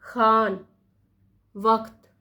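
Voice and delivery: खान raspy and वक्त throaty; nukta pronunciation. Shown as a demonstration. Teacher repeating both nukta words. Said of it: "कैसे पढ़ रही हूँ उसको".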